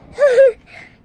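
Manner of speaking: loud laugh. Real, not acted. Laughing at a funny remark.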